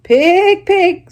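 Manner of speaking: high, loud coaxing call; kind farmer call. Acted. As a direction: sweet-talk the pig along. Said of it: "in a very kind voice".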